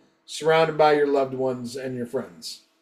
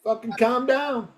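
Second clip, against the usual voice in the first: retold placating protest; 7 semitones higher than usual. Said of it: "and I'm like".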